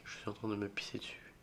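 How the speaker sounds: fast breathless whisper; frightened whisper in the dark; scared whisper. Real, not acted. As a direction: whisper it, too scared to speak up. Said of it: "Est-ce que j'ai peur? Oui".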